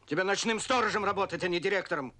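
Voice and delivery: shouted insult, high and loud; explode, then keep berating loudly; angry shouting. Acted. Boss berating his subordinate, angry shouting. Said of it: "очень много шумишь".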